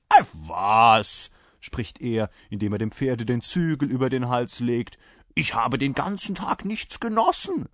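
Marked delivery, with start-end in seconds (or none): 0.1-1.3 loud, scornful brush-off; dismissive rider. A narrator's reading. 5.3-7.7 high, complaining soldier voice; indignant rider. A narrator's reading. Rider insists on his drink.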